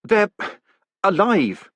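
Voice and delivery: a man just pulled from suffocating mail; gasping. A narrator's reading. Moist gasping to the golem after nearly suffocating, frightened gasp. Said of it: "he gasped".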